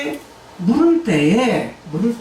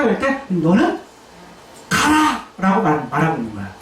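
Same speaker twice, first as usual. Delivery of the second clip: ordinary talk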